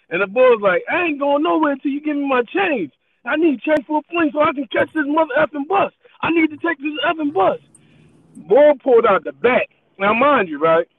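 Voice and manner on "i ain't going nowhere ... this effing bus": higher, insistent demand; demanding customer. Retold or imitated. Customer demanding his change from the cashier, insistent. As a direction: refuse to leave without the change.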